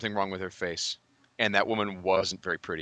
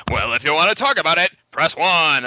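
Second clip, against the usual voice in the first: loud, high hotline-announcer voice; Moviefone guy; 7 semitones higher, 6 dB louder than usual. Retold or imitated. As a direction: blare it like a phone menu prompt. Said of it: "he actually really talks like that too".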